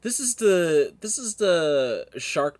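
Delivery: high, loud, drawn-out squeal of recognition; excited shriek. Real, not acted. Gamer recognizing the shark, squealing excitedly. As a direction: blurt it out thrilled, way up high.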